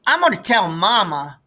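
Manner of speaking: put-on high, loud childish threat; little sister's threat. Little sister threatens to tell Mama, high and childish. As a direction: tattle like a small girl.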